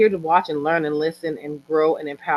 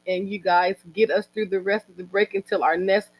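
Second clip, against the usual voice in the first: ordinary talk, nothing marked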